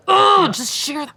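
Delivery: high, drawn-out fed-up groan; mock exasperation.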